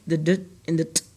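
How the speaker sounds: "d" and "t" given as short letter sounds; isolated suffix sounds. Shown as a demonstration. Teacher listing the E D suffix sounds, short isolated "d" and "t". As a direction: say the sounds of "d" and "t", not the letter names. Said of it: "it makes the ed".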